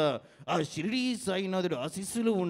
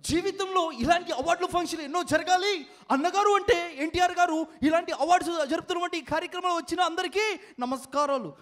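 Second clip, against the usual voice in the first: very high-pitched put-on speechmaker; high mimicry voice; 10 semitones higher, faster than usual. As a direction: address the crowd in a high impersonated voice. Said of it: "unique mimicry concept". (బాగా హెచ్చు స్థాయిలో mimicry గొంతు)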